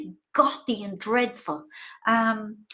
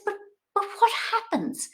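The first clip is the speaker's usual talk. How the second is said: high, softer, baffled question; puzzled mammy voice; 10 semitones higher, 4 dB quieter than usual. Retold or imitated. Her mother baffled by the plot, high and puzzled. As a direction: ask it bewildered like an old mum. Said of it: "And she says".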